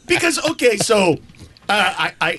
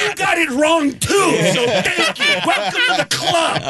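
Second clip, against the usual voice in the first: higher, shouted comeback through laughter; mock retort; 8 semitones higher than usual. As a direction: fire it back half laughing. Retorting to coworker.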